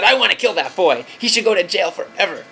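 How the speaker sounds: put-on high, loud furious kid; angry child. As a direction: shout it like a boy who wants revenge. Angry son quoted by father.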